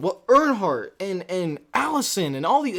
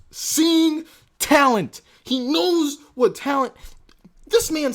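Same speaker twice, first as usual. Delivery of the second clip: excited; 6 semitones higher, 4 dB louder, slower than usual. Real, not acted. Praising scout, drawn out.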